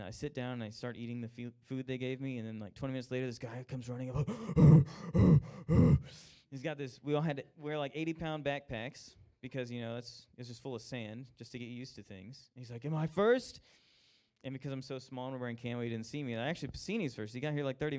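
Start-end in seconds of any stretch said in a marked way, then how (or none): 4.3-6.3 big out-of-breath gasp, much louder. Retold or imitated. Exhausted runner arriving at the finish, loud gasp. 12.9-13.6 higher and louder, gasping out the question; breathless call. Retold or imitated. Runner arriving at the finish line, breathless loud call.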